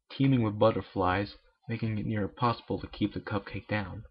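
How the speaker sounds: ordinary delivery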